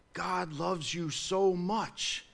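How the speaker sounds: slow, soft, drawn-out sweet talk; softened voice, words stretched out; mock gentle evangelism. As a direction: imitate a tender evangelist, softly and slowly. Mimicking modern evangelism's soft opening pitch.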